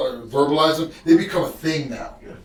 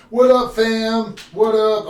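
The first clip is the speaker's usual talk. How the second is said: higher, slower hammed-up hype greeting; mock cheerful greeting; 7 semitones higher, 4 dB louder, slower than usual. Dad mocking the cheery hello he won't give family, hammed-up. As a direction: play the upbeat dad he can't be tonight. Upbeat greeting he can't fake.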